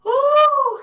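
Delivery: loud high yawning ooh; big yawn. Real, not acted. Tired instructor yawning late in the evening, high loud yawn. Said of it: "big yawn late in the evening".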